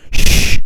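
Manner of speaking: sharp loud shush. A narrator's reading. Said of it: "hissed Professor McGonagall".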